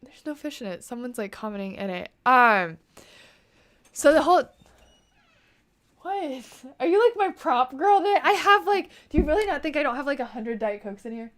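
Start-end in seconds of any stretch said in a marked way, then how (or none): 3.9-11.3 high, loud, disbelieving questioning; real incredulous outburst, pitched way up. Real, not acted. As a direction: challenge your helper in amused disbelief. Startled by helper bringing a prop.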